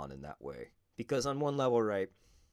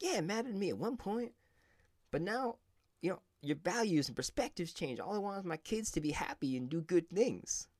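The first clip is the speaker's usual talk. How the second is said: Mike Tyson impersonation; 12 semitones higher than usual. Retold or imitated. Podcaster imitating the boxer shrugging off his belts, much higher put-on voice. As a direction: pitch it way up like a famous heavyweight brushing off praise. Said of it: "I'm gonna do my best Mike Tyson impersonation".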